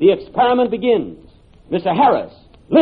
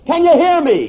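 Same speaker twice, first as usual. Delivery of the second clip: calling out; 6 semitones higher than usual. Acted. Shouting down a bad line.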